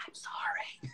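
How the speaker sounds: tiny high whispered apology; timid whisper. Pretend. The imagined cowed kid after answering, whispered apology. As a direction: breathe the apology like a scared child.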